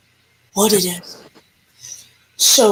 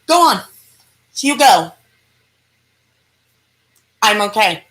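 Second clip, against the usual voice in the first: higher, louder push to go on; urging him on; 3 semitones higher, 5 dB louder than usual. Retold or imitated. Frightened painter sending the narrator through the tunnel, raised urging. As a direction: hurry someone ahead with a raised voice.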